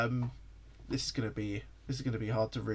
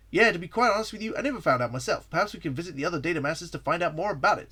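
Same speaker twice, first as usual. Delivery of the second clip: higher, louder hero voice; easygoing hero, pitched up a little; Sonic; 5 semitones higher, 5 dB louder, faster than usual. Acted. Sonic answers the visiting journalist, lively hero voice.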